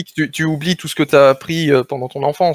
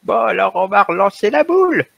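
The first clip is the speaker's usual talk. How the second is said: put-on little old voice; 4 semitones higher than usual. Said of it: "leur petite voix de vieux".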